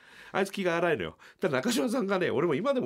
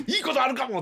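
high, laughing excited cry; giddy hope; 13 semitones higher, 6 dB louder than usual. Retold or imitated. Comedians hoping for good luck, giddy laughing cry.

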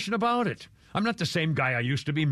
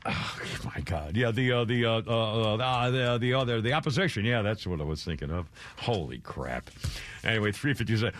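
mock old man; 8 semitones lower than usual. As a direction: lower the voice way down and grope for words. Mocking Biden's lost words.

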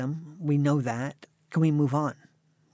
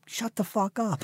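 high-pitched mock rude outburst; mock-indignant brush-off, pitched up; rude retort; 7 semitones higher than usual. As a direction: tell them off in a raised, comic pitch. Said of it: "That's how I say to old people now".

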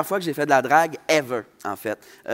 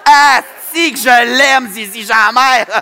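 crier son amour pour elle, fort et lent; un cri de fan aux anges; cri d'amour; 9 semitones higher, 12 dB louder, much slower than usual. Real, not acted. Crie son amour pour Zizi. (cri de fan enthousiaste)

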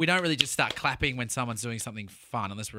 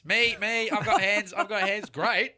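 higher, eager celebrating voice; pitched-up excited role-play; acted excited soldiers; 6 semitones higher than usual. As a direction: act out a gleeful post-battle exchange. Acting out war winners celebrating.

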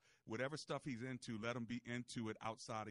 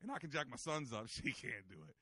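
ordinary talk, nothing marked

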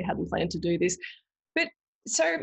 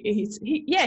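ordinary talk

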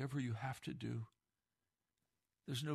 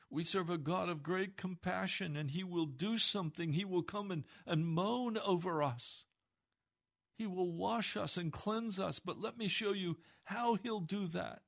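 high, loud, pleading preacher's cry; impassioned sermon climax; fervent preaching; 6 semitones higher, 4 dB louder than usual. Acted. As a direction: build the plea to full force. Rising preaching on God's compassion.

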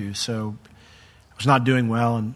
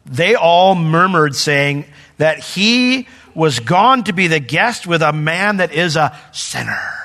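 slow, loud reading of the verse; scripture reading; 4 semitones higher, 5 dB louder, slower than usual. Shown as a demonstration. Preacher reading scripture aloud, slow and loud. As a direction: read the verse out, slow and grave. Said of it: "It says".

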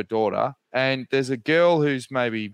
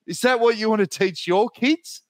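imitating the partner; 8 semitones higher than usual. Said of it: "doubles down with".

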